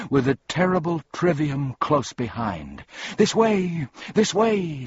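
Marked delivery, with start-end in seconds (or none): 3.2-4.9 high, loud guiding call. A narrator's reading. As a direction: call them over from a distance. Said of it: "the voice called again".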